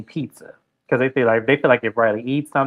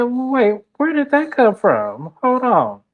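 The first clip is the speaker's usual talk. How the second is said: mock confused Georgia; 11 semitones higher, slower than usual. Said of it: "Georgia looked at her and said".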